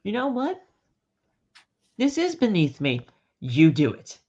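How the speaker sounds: high smug Reigns; hammed-up boss passing the job. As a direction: hand it off with mock dismissal. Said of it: "Reigns like".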